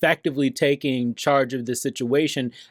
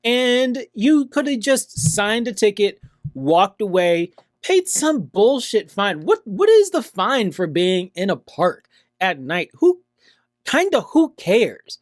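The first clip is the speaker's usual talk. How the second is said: exasperated scolding; 7 semitones higher than usual. Real, not acted. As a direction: scold her like she blew it. Scolding woman in clip.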